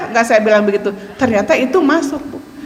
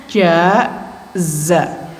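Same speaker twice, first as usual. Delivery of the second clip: ordinary delivery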